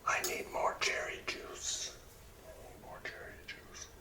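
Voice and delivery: usual speaking voice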